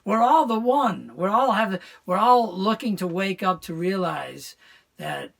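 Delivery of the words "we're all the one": ordinary talk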